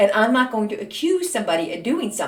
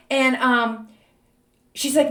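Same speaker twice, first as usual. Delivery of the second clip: normal talking voice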